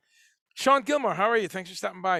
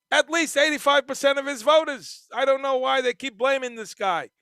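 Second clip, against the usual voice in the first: higher, louder voice for the viewer Raymond; voicing Raymond; 9 semitones higher, 10 dB louder than usual. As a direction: play Raymond as an excited fan, pitched up.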